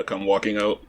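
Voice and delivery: ordinary talk, nothing marked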